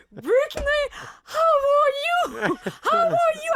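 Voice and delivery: put-on high, drawn-out Beauce-accented English; accent beauceron. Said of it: "Avec un accent très beauceron". (accent beauceron très fort, voix aiguë)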